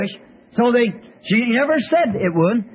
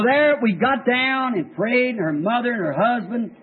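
usual speaking voice

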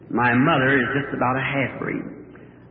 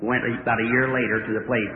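usual speaking voice

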